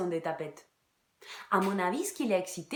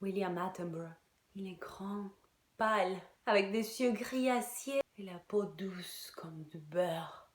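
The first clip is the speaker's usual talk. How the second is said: swooning whisper; 8 dB quieter than usual. Acted. Describing her English lover in a swooning whisper. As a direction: breathe it like a lovestruck confession.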